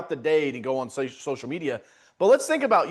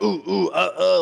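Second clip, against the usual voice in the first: caveman grunts; 4 dB louder than usual. Retold or imitated. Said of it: "I don't know if that's exactly how caveman sounds".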